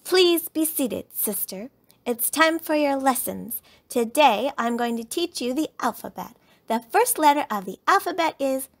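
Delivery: lightly voiced little schoolmistress; bossy teacher. A narrator's reading. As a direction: announce the lesson with pretend authority. Lizzie playing teacher to Sister.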